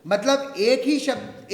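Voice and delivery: normal talking voice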